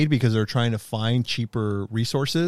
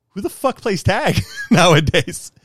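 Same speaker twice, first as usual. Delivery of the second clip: incredulous wife; 7 semitones higher, 5 dB louder, slower than usual. Retold or imitated. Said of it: "And she's like".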